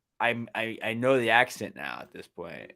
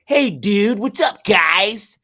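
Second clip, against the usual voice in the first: much higher, louder, slow bro greeting; 11 semitones higher, 11 dB louder, much slower than usual. Retold or imitated. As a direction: ham up a dude-bro hello. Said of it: "And I was like, "No, spot on".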